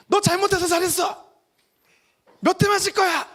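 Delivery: high-pitched, acted parental telling-off; mock threat to a naughty child; scolding parent. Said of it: "마치 부모가 잘못하고 실수한 이 자녀들을요".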